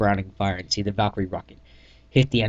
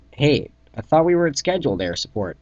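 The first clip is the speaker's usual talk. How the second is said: louder, higher, alarmed question; raised voice; 6 semitones higher, 5 dB louder than usual. Acted. Soldier questions squad about air support, raised voice. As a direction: call it out over the noise.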